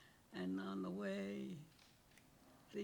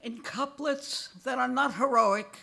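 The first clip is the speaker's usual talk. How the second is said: rhythmic recital, louder on the defiant couplets and slowing toward the end; poem reading; 11 dB louder, slower than usual. Acted. Aging poet reads his poem Catalog to the audience, rhythmic and wry. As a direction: give each couplet its beat, then ease into mourning.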